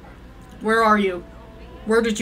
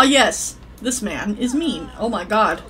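ordinary talk, nothing marked

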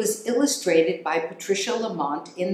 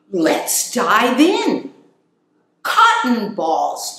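louder, slow delighted cheer; 4 semitones higher, 7 dB louder, slower than usual. Acted. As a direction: cheer like a hamster finding treasure. Hamsters find bin, cheer.

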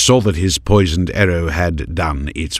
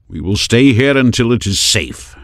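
steady, raised warrior voice; firm protector; 6 semitones higher, 5 dB louder than usual. A narrator's reading. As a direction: settle it like a guard. Heron commands in hiding.

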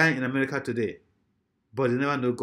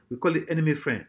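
usual speaking voice

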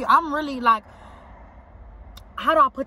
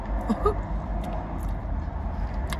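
high breathless giggle at her own story; breathy laugh; 10 semitones higher, 7 dB quieter than usual. Real, not acted. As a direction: laugh it off, airy and unvoiced.

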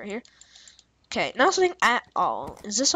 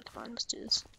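normal talking voice

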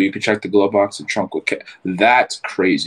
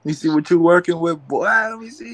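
frat bro; 11 semitones higher than usual. Pretend. Greeting buddy in hyped bro voice.

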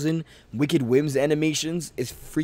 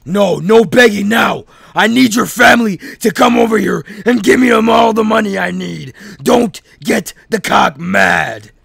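menacing villain; 3 semitones higher, 9 dB louder than usual. Acted. Captor to Tyrell, loud and menacing. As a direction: shut down the begging with a threat. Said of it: "I'm in my actor bag right now".

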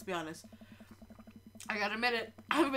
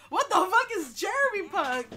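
incredulous outburst; 9 semitones higher, 10 dB louder than usual. Real, not acted. Baffled at a made-up genre.

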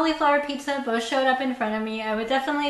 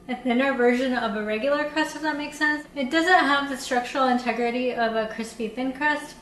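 usual speaking voice

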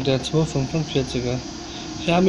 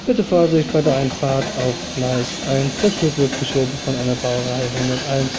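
station announcer reading train times; calm, even railway tannoy read; flat platform announcement. Acted.

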